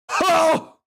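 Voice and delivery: high shouted villain grunt. Acted. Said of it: "I couldn't do that grunt".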